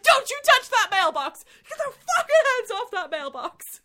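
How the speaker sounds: angry shout. Acted. Carl yelling at developers to leave his mailbox, furious shout. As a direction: roar at them to get away.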